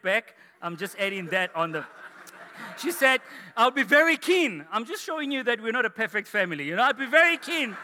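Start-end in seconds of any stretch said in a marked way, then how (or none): 3.6-4.7 higher, louder mock eagerness; eager reply. Acting wife's over-eager reply. 6.8-7.8 raised, teasing show of keenness; eager reply. Retold or imitated. Repeats wife's over-eager reply.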